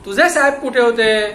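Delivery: loud, high barked question; sharp demand. Acted. Inspector demanding answers from the watchman, loud. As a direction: snap the question at him.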